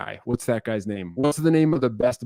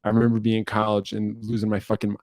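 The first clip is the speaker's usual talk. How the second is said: usual speaking voice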